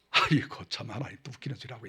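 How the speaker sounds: soft, quick scoffing mutter under the breath; a dismissive aside with a sneer; scoffing Abraham. Retold or imitated. Preacher voices Abraham scoffing at God's promise. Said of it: "그 웃음이 아니고".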